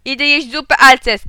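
loud, high call into the phone; shout. A narrator's reading. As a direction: shout a hasty sign-off. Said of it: "krzyknąłem". (głośny krzyk do słuchawki)

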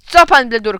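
loud, snapping question; angry shout. A narrator's reading. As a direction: shout back in irritation. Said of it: "krzyknął tata".

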